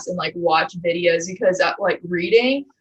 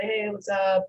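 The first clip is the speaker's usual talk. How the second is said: slow chill greeting; 8 dB quieter, slower than usual. Shown as a demonstration. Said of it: "I'll talk slower and I'll be like".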